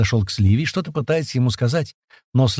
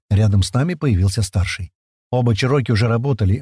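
ordinary delivery

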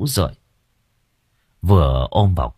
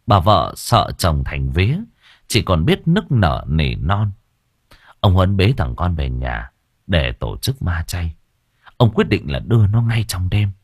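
plain reading voice

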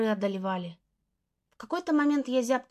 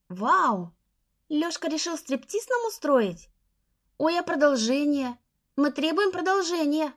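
clamor for more, laughing; teasing girls clamoring; 4 semitones higher, slower than usual. Acted. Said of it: "Заголосили девчонки, смеясь".

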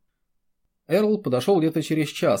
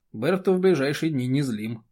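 usual speaking voice

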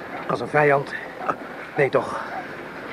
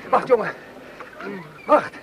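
alarmed pleading; 9 semitones higher, 4 dB quieter, slower than usual. Acted. Friend stops wounded man rising.

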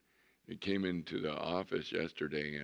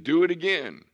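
loud order, higher; commanding; 8 semitones higher, 10 dB louder than usual. Retold or imitated. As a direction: bark the order to the servants. Elijah commanding servants.

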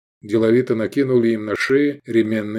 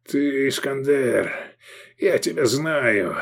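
slow, raised, strained rasp; hoarse, halting voice; hoarse croak; 4 semitones higher, slower than usual. A narrator's reading. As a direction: wheeze out the recognition slowly. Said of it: "прохрипел Мирарзал".

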